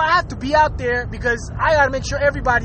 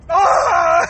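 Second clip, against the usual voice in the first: loud, higher groan of frustration; exasperated groan; 5 semitones higher, 4 dB louder than usual. Real, not acted. Ranting at the president to viewers, exasperated groan.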